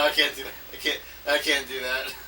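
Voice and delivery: usual speaking voice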